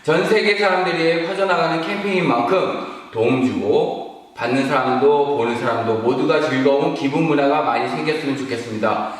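ordinary delivery

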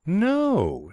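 high, drawn-out no; long, high-pitched negative; blank refusal. A narrator's reading. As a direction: airily deny understanding. Mifflin refuses to understand.

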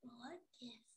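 whispered, low and puzzled; very soft, breath-only question; hushed question. Acted. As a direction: ask it in a whisper. Bella puzzled, asks parents.

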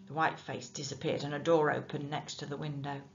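usual speaking voice

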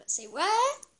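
high, surprised "say what"; mock surprise. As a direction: act baffled. Family's retold confused reaction.

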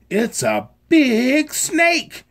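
high, loud, slow scared cry; alarmed high cry; frightened cry. A narrator's reading. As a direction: shriek it out in alarm. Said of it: "cried the nine hundred".

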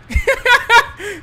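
high loud laugh; high cackle. Real, not acted. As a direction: burst out laughing. Laughs at the killer.

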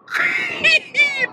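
a high shrieking laugh. Real, not acted. Laughing at the lawsuit news.

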